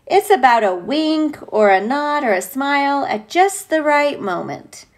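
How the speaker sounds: usual speaking voice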